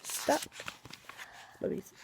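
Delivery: usual speaking voice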